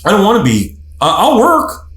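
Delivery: a hint of a guy haggling over chores; mildly voiced husband laying out his deal; bargaining guy. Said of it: "that guy says".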